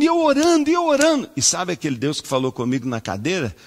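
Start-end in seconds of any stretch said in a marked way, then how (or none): 0.0-1.3 high, loud, desperate repetition; desperate praying. Acted. Pastor pouring out his desperation while praying. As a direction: cry it out in anguish.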